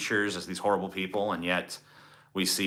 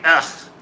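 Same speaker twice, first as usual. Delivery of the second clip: higher, louder retold ugh; annoyed groan; 7 semitones higher, 7 dB louder than usual. Retelling being cut off in traffic, annoyed groan. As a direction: let out a short irritated grunt. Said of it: "It's just like".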